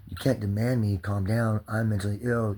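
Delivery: ordinary talk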